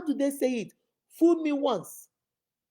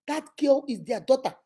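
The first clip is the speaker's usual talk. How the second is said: ordinary talk, nothing marked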